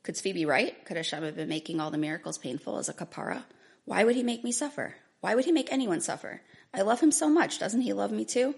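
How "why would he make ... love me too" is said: desperate, pleading with God; anguished rising plea. Acted. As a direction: voice climbing higher and louder in despair. Anguished reading of her breakdown.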